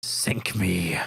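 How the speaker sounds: whispered low curse; pirate whisper. Acted. Cornered pirate witness whispers a curse to himself. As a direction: hush it out like a cornered sailor.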